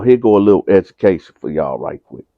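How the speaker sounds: ordinary talk, nothing marked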